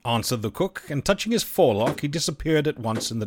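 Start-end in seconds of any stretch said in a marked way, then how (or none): none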